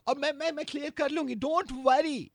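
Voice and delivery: ordinary delivery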